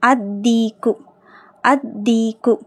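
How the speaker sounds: "அத்தீக்கு" with doubled D and long ee, no L; careful teaching model of "அத்தீக்கு", L unheard; lam silent. Shown as a demonstration. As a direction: lean on the double D of "அத்தீக்கு", dropping L. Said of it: "லாம் உச்சரிக்கப்படக்கூடாது".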